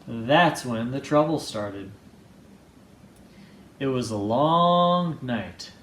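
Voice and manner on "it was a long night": ordinary delivery, nothing marked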